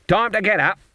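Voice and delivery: high-pitched, bright wake-up call; unusually cheerful. A narrator's reading. Granny wakes the sleeping girl, unusually cheerful. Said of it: "in an unusually cheerful voice".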